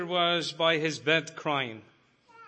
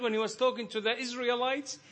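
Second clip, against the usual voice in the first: full-force preaching; 7 semitones higher than usual. Acted. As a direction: preach it out with rising fire. Preaching voice continues.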